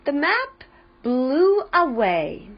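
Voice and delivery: plain reading voice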